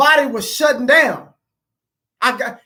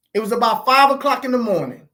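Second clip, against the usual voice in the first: ordinary talk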